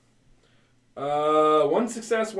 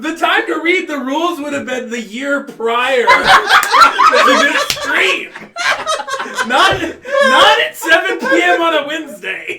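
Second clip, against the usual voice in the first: laughing through the words; high, loud, breaking into laughter; 9 semitones higher, 8 dB louder, slower than usual. Real, not acted. Laughing while scolding friend.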